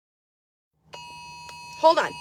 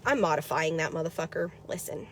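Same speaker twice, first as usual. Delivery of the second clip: much softer, low confiding mutter; hushed aside; 9 semitones lower, 12 dB quieter than usual. Real, not acted. Telling viewers she'll change the shirt, hushed. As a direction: lean in and drop the voice.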